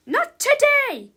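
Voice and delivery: loud, high, stretched-out snap; irritated teacher's yell; snapped. A narrator's reading. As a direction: cut the cheering down with a shout. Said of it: "snapped Miss Ribble".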